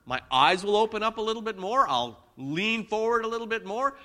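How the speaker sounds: getting worked up. Shown as a demonstration. Preacher showing church his anger signs, worked up. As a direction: speed up and push it as anger builds. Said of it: "I'll start talking faster and louder".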